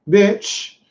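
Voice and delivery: ordinary delivery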